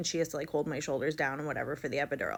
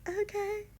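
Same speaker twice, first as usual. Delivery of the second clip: very high, tiny uncertain okay; mock-confused high voice; disoriented; 3 dB quieter than usual. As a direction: squeak out a bewildered agreement. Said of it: "I'm, like, so disoriented".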